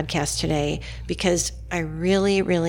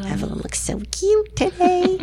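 cooing; 12 semitones higher than usual. Real, not acted. Host coos over guest.

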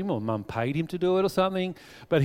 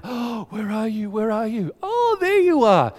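high, drawn-out pretend searching; mock pretend search; 7 semitones higher, 5 dB louder than usual. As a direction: show how to pretend you can't see. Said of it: "and he goes".

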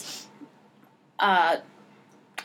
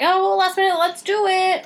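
flippant producers; 8 semitones higher than usual. Retold or imitated. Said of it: "they're like".

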